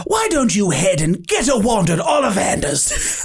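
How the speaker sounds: steer the guest away in a hammed-up elf voice; elf staffer in character. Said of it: "And he goes".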